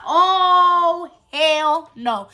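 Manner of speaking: long, loud, high drawn-out "oh"; indignant refusal. Retold or imitated. Girl answering the boy who insulted her, indignant. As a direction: act out outraged disbelief, stretching the first word.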